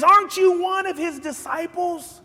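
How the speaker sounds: put-on high, prying girl's voice; servant girl. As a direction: accuse him lightly. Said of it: "She says".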